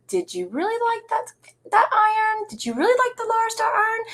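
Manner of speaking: mock fan question. Quoting quilters asking about the iron, high and curious. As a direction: quote the eager fans lightly. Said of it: "people come up to me and go".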